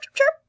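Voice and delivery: high bright chirp chirp like a little bird; teacher imitating a bird chirp; bird chirp imitation. Shown as a demonstration. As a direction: chirp like a songbird for the kids. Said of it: "it goes chirp".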